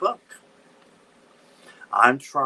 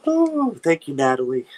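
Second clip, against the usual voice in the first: grateful exclaim; 6 semitones higher, 8 dB louder than usual. Real, not acted. Viewer comforts her.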